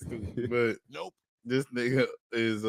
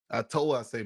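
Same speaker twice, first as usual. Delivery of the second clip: normal talking voice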